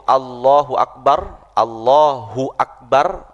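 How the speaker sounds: slow, careful Allahu Akbar; every letter clear. Shown as a demonstration. Teacher showing students the takbir, slow and careful. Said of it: "itu semua hurufnya".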